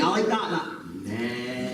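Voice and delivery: laughing imitation. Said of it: "I was like".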